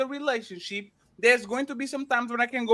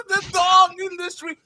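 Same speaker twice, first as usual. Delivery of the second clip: high fake sob over the dog; a hammed-up fake cry, louder and higher; mock sobbing; 7 semitones higher, 5 dB louder than usual. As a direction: blubber like a crybaby, hammed-up. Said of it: "and I was like".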